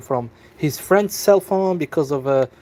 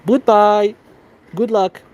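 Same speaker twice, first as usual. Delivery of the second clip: mocking goodbye; 6 semitones higher, 4 dB louder, slower than usual. Hanging up on the scammer.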